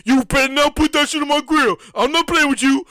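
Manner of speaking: cousin warning. Retold or imitated. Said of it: "He probably would say".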